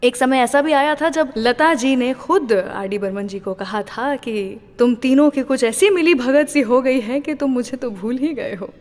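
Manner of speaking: ordinary delivery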